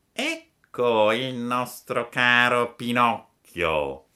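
sly fox hugging its prey; fox's greeting. Acted. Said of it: "gridò la volpe abbracciandolo". (la volpe saluta Pinocchio, voce lenta e melliflua)

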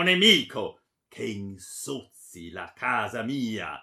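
loud, thundering tirade; furious accusation. A narrator's reading. Baron accusing his daughter of dishonour, furious. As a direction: denounce her at full force, raging.

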